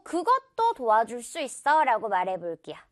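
ordinary delivery

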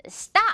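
crisp model of Stop, t like Korean ㄸ; tense-t stop. Shown as a demonstration. Teacher repeats the model for learners, tense-t Stop. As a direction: Stop high-pitched, with a tight unaspirated t. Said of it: "이렇게 발음을 해줍니다".

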